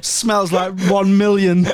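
lightly hammed-up drunk lad; drunk mockery. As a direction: slur it like a wasted lad. Mocking James's drunk voice.

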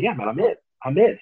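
building excitement on the second I'm in; excited yes. Retold or imitated. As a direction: jump at the offer with delight. Eagerly accepting the offer.

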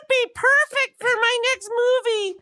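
absurdly high-pitched, slow director impression; comically high, stretched-out voice. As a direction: squeal it slowly, drawing out the words. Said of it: "why did Frank Darabont have a high, very high voice".

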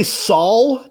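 "Saul" slowly, saw ending in L. Retold or imitated. Asking about Dave's accent, saw said as "Saul". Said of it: "Sounds like you got L".

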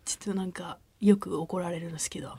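sheepish whisper. Retold or imitated. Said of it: "shy staff retold".

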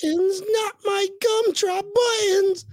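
very high, drawn-out cartoon plea; squealed pleading. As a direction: beg in a tiny squealing character voice. Squealed begging with movie-character catchphrase.

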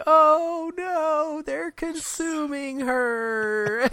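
high, slow mock alarm; high drawn-out mock panic; quoting Bailey. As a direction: squeak it out like a panicked whale. Bailey's line quoted from the film.

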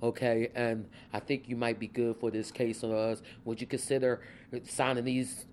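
low, soft lawyer impression; measured, deep-pitched quote of a colleague; Michael Churchill. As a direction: drop the voice low and imitate the attorney. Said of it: "that's my Michael Churchill voice".

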